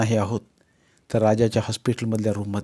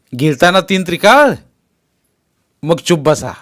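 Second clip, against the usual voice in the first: louder, furious yelling at the father; shouting; 3 semitones higher, 8 dB louder than usual. Acted. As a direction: shout him down, no more lectures. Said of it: "तर दादा ओरडला". (बापावर चिडून ओरडणारा मुलगा)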